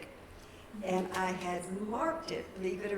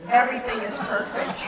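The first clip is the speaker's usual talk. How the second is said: punchline; 5 semitones higher, 12 dB louder than usual. Acted. Joke punchline delivered.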